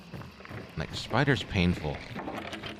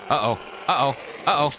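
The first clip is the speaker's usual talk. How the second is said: nervous uh-oh; 5 semitones higher than usual. Real, not acted. Monster approaching in game.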